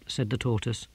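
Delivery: plain reading voice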